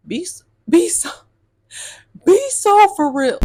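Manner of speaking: high, loud "be so for real"; exasperated demand. Real, not acted. To her viewers, an exasperated high demand. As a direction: plead for some seriousness.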